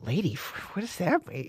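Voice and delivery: unvoiced, barely there puzzled whisper; hushed baffled whisper; whispered confusion. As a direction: mutter it to yourself, baffled. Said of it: "And I just thought".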